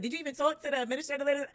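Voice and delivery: high, fast questioning voice; mock the family's doubt; doubting relative. Quoting family questioning her plans, high and fast.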